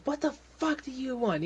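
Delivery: high-pitched, exasperated retort; annoyed boss answering an interruption; irritated snap. Acted. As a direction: voice jumps up in irritation.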